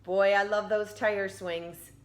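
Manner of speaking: ordinary delivery, nothing marked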